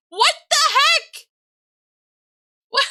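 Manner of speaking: high, loud incredulous outburst; shocked, pitch shooting up; stunned disbelief. Real, not acted. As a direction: burst out in disbelief. Reacting to a big gift.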